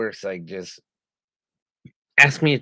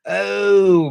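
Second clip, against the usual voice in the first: gasping oh of recognition; sudden high gasp; surprised gasp; 9 semitones higher, 5 dB louder than usual. Real, not acted.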